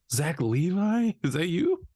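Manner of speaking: mock surprise.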